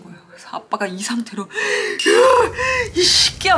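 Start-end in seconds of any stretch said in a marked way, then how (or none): 1.5-2.9 high, loud enraged outburst; imitated raging cry; furious roaring gasp. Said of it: "막 이러면서 막 쌍욕을". 3.0-3.6 loud, high raging yell at the man; father screaming curses, near tears; furious shout. Retold or imitated. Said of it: "막 이러면서 막 쌍욕을".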